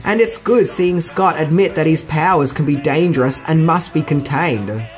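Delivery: ordinary talk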